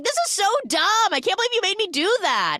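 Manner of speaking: high-pitched, quick mock whine of protest; exasperated complaint. Retold complaint after falling in garbage.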